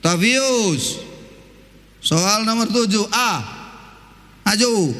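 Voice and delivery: lightly voiced teacher calling a pupil forward; a hint of a stern teacher, slow. Preacher imitating his math teacher calling him to the front. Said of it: "dipanggilnya lagi, namanya guru".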